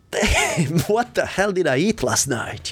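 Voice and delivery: high, laughing disbelief at his own dream; amazed waking laugh. Retold or imitated. A man wakes from a violent dream, high-pitched laughing disbelief. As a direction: laugh at how wild the dream was. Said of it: "I woke up and I was like".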